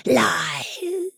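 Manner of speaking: high, eerie whispered taunt; hoarse whisper. Acted. Hidden goblin whispers its taunt from the dark. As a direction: breathe it out like a ghost. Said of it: "a hoarse, indistinct voice".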